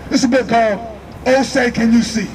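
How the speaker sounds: ordinary talk, nothing marked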